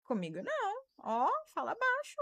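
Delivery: high-pitched firm refusal without shouting; adult setting limits. Shown as a demonstration. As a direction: draw the line like an adult. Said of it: "O adulto coloca o limite".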